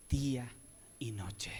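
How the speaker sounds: "noche" whispered, soft and breathless. Shown as a demonstration. Preacher to his flock, hushed whisper. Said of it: "No, no dice".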